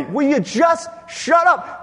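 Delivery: high, loud, slow scolding; exasperated shout. Acted. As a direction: shout them down, leaning on each word. Crowd exasperated at the beggar.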